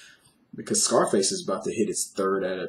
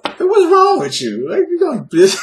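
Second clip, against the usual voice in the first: mock outraged scolding; 12 dB louder than usual. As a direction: berate the writer with hammed-up incredulity. Mock scolding of the email writer.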